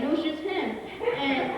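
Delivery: ordinary talk, nothing marked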